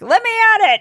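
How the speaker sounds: high, loud battle cry; hammed-up, high-pitched challenge; eager mock fighter. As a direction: play an overeager brawler itching to fight. Acting eager background fighters.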